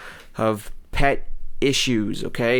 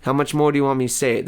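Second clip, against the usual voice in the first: usual speaking voice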